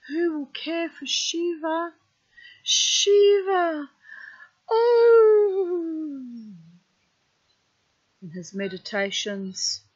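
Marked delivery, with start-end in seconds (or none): 0.1-6.5 much higher and much louder, a piercing scream; anguished shriek. A narrator's reading. Vijaya screams to Shiva over Sati's body, anguished shriek. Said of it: "Vijaya's shriek".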